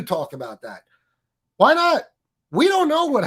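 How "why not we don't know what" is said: high, loud and indignant; loud exasperated demand. Real, not acted. Ranting streamer demands why critics stay silent, loud and high. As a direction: throw the question out loud.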